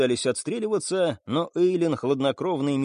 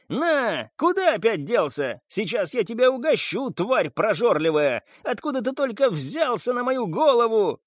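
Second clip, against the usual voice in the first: high, frantic shouting pirate boss; hysterical shouting; 8 semitones higher than usual. A narrator's reading. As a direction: yell wildly at a vanishing target. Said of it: "истерично кричал главарь бунтовщиков".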